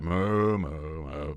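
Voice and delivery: idle cow mooing that sinks away; indifferent herd of cows. Acted. Other cows answer the first one, idle low mooing. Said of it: "all the other cows are like".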